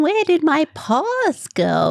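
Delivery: high, slow Australian 'paws' with an open vowel; Australian accent. Shown as a demonstration. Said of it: "you can't tell the difference between".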